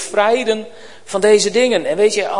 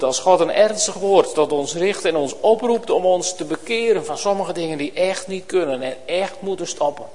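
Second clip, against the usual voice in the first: usual speaking voice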